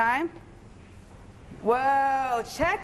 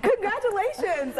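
drawn-out congratulations through a laugh, higher; 8 semitones higher, slower than usual. Real, not acted. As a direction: laugh it out slowly, pitched up. Laughing after near-soaking by water.

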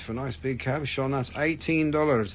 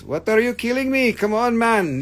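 mock protest; 10 semitones higher, 6 dB louder than usual. Protesting half-price deal to chat.

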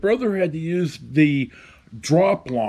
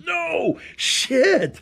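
a much higher, panicked yelp of no; retold scream; 12 semitones higher than usual. Sergeant screaming down the line at the brother, high panicked yelp. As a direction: squeal it out in alarm, pitch flying up. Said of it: "And the sergeant screams".

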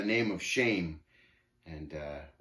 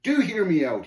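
louder, higher insistence; demanding; 7 semitones higher, 7 dB louder than usual. A narrator's reading. Shame poking the pilgrim's chest, demanding. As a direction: insist on being heard. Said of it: "he demanded".

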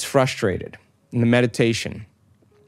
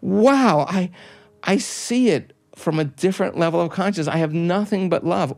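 mildly acted wonder, pitched higher; amazed realization; 5 semitones higher than usual. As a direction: voice someone struck by a new insight, higher. Voices a person's amazed realization.

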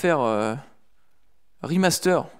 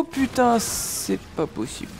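sharp high swear, cut short; frustrated outburst; 10 semitones higher, slower than usual. Real, not acted. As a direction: blurt it out in frustration. Enemy escapes, frustrated outburst.

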